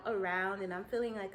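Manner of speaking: ordinary talk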